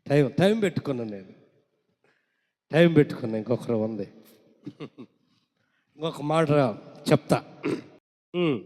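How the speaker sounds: usual speaking voice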